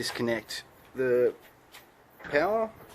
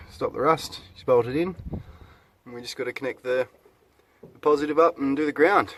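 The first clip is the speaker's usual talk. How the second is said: ordinary talk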